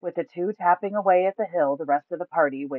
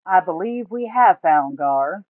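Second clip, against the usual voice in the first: slow drawled words, a bit louder; 4 semitones higher, 6 dB louder, slower than usual. A narrator's reading. Randonk announcing the find, slow drawl. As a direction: stretch it out lazily. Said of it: "Randonk drawled".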